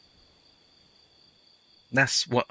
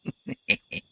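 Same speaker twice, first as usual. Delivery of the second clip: quiet unvoiced chuckle; breathy laugh; 4 dB quieter than usual. Real, not acted. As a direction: laugh softly under breath. Laugh after joke.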